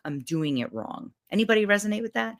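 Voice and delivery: ordinary delivery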